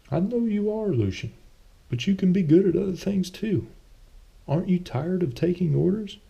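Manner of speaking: put-on high, pleading old friend voice; Thomas pleading. A narrator's reading. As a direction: beg him to stop taking orders.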